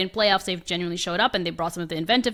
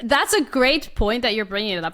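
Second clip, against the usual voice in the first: ordinary talk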